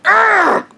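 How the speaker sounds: a very high, strangled cry of frustration; mock a man who has lost the argument; frustrated growl. Imitating Blondie, beaten in an argument: high strangled "argh". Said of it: "And he went".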